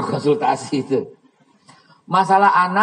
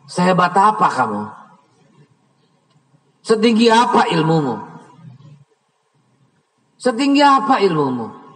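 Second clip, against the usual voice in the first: angry rebuke; 3 semitones higher, 3 dB louder than usual. Retold or imitated. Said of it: "panas saya ya".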